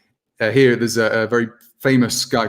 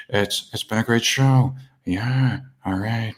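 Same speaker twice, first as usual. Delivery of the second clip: Jeff Goldblum. Acted. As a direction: say goodbye haltingly, stretching words in an impersonated voice. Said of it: "I've got Jeff Goldblum ready".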